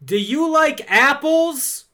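high, loud, stretched-out taunt; movie tough guy's taunt. Acted. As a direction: call it out high and slow to bait him. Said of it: "he walks up to him and he goes".

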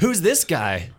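high, loud mock puzzled listener; imagined new listeners. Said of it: "They're like".

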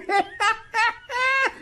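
high, gleeful cackle; sustained high cackling; gloating laugh. Acted. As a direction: laugh in triumph at a rival.

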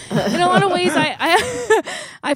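tearful laugh breaking through the words; laughing through tears. Real, not acted. Friend thanking Helen on the podcast, laughing through tears. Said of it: "And I almost made it without crying".

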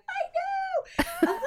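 very high delighted squeal; mock delight. Author answering fans who praise her mouse, mock delighted squeal. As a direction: squeal it high, hamming up the pride.

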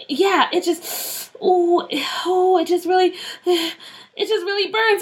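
high, slow, halting words through pain; acted burning pain. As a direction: struggle to talk as the burning builds. Scalp burning, about to scream.

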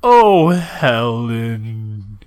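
very slow flat wooden call; a hint of a bad actor's moan; poorly delivered line. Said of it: "really kind of poorly delivered".

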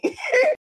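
short rising laugh; high giggle. Real, not acted. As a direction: giggle at her own joke. Laughing at McDonald's joke.

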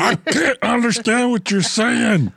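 high, slow, hammed-up Southern drawl; exaggerated Southern accent, drawn out through laughter; mock Southern accent. Joking about needing subtitles, in a mock Southern accent. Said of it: "super Southern accent".

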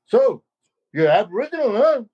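a hint of a curious old uncle; old uncle's question. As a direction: ask it like a gently teasing elder.

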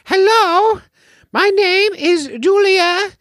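very high, falsetto mock British accent; [name]'s British accent. Host trying to match a co-host's accent, hammed-up British falsetto. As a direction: put on a terrible, squeaky British lady, drawn out. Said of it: "British accent".

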